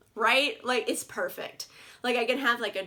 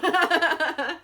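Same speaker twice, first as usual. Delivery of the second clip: laughing; 5 semitones higher, 5 dB louder than usual. Real, not acted. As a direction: burst of rapid giggles. Laughs at own ice cream joke.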